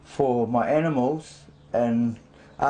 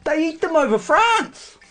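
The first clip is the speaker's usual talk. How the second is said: excited exclamation; 13 semitones higher, 5 dB louder than usual. Real, not acted. Sudden high exclamation.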